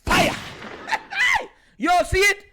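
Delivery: loud, high, excited shouts of fire; mock celebratory yelling; hype shouts. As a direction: hype it up like a highlight reel. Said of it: "Lamar said".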